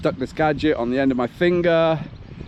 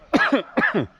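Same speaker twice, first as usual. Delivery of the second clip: high sharp dry cough; sudden tight cough; 12 semitones higher than usual. Real, not acted. Coughing mid sentence.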